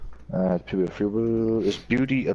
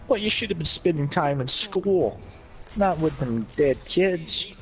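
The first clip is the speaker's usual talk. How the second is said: put-on Brooklyn accent; 7 semitones higher than usual. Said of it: "this is my Brooklyn accent".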